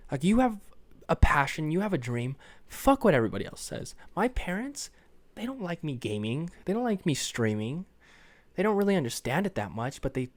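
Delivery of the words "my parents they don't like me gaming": usual speaking voice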